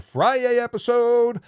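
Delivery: loud, high, drawn-out Friday episode; hyped announcement. Real, not acted. Host plugging the next stream to viewers, hyped call-out. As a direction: call it out like a show title.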